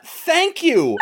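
high, disbelieving thank you; mock incredulous thanks. Friend mocks the woman's thanks, high and incredulous. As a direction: say it like the scene makes no sense. Mocking the film's line.

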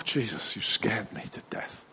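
slow, breathy-soft whisper of fright; hushed, slow, scared-to-death whisper; startled whisper. Acted. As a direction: whisper it, still shaken. Disciple startled by Jesus appearing.

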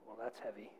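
very soft, low awkward murmur; subdued reaction. Retold or imitated. Other kids react to her confession, hushed and uneasy. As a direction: imitate uneasy kids, barely audible.

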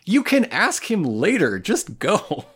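high-pitched, pleading scold; mock exasperation. Telling off a nosy friend, playfully exasperated.

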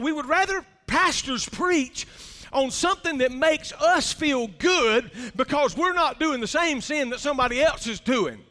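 ordinary talk, nothing marked

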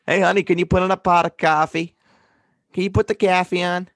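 high, loud Long Island nagging; exaggerated Long Island accent. Spouse asking for coffee, exaggerated Long Island accent. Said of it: "your exaggerated Long Island accent sounds part Boston".

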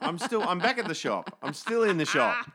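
in-denial shop lady. Retold or imitated. Op shop woman blocking out swearing, high loud cheerful denial voice. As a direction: cheerfully pretend you can't hear a thing. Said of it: "imagine her with her hands clapped over her ears".

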